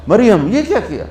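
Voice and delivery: mock reproach; mocking taunt. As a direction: taunt her like the jeering crowd. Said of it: "تین طرح سے طنز کیا ان کو".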